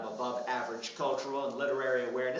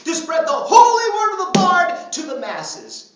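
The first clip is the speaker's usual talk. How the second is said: loud, rousing revival sermon; high-energy tent preacher, building to a shout; mock evangelist preaching; 7 semitones higher, 10 dB louder than usual. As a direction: proclaim salvation to the masses with hammed-up fervor. Mock preacher proclaims mission.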